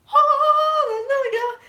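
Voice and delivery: high, loud, drawn-out shout of joy; ecstatic cry. Retold or imitated. Fan cheering as the axe returns, ecstatic shout. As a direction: belt it like the heavens opened. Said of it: "I was like".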